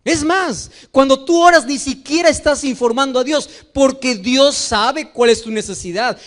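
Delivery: usual speaking voice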